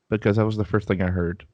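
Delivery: ordinary delivery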